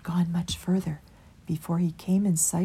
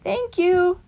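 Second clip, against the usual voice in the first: higher, louder thanks called out; 12 semitones higher, 5 dB louder than usual. A narrator's reading. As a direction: call it after them while running. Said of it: "called Christopher".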